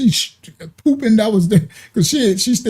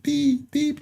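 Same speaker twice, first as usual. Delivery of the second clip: soft, higher mock beeps; high monitor beeps; 8 semitones higher, 8 dB quieter than usual. Imitating the heart monitor, soft high beeps. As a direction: chirp them softly up high.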